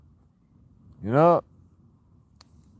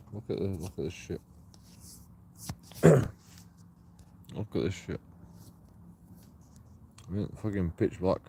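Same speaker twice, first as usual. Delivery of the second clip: muttered; 5 semitones lower, 10 dB quieter, faster than usual. Real, not acted. Muttering alone in the dark.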